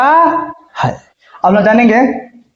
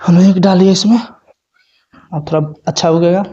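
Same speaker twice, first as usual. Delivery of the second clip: whispered aside. Real, not acted. Aside while refilling ink.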